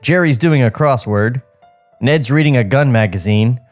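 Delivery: ordinary talk, nothing marked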